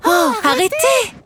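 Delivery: put-on a little girl begging the puppets to stop; crying out to stop. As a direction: Bella pleading with the quarreling puppets. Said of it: "s'écriaient Bella et Madelon".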